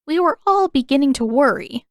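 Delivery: a motherly elder fussing over her; old woman's worry. A narrator's reading.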